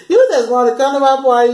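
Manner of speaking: amused laughing repeat. Retold or imitated. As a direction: laugh as you call out the fake apology. Laughing at the fake apology.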